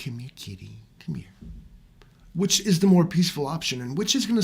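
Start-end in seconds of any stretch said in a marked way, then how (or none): 0.0-1.3 soft coaxing kitty voice, much softer; coaxing a cat. Pretend. Calling the meowing cat to him, soft and coaxing. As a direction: gently call the cat over. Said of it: "Or do I be like".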